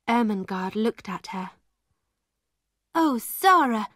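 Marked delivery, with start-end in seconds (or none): 2.9-3.9 loud, high alarmed cry; cried out. A narrator's reading. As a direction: call out in worried shock. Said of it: "she cried".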